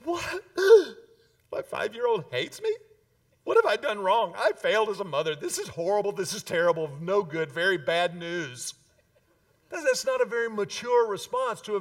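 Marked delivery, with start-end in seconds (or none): 0.0-0.4 high, sudden mock shock; shocked mother. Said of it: "I'm not gonna be the mother who goes". 0.5-1.0 loud, high theatrical gasp; mock gasp. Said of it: "I'm not gonna be the mother who goes". 1.5-8.7 mock panicked mom, hammed-up; hysterical mother. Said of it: "I'm not gonna be the mother who goes".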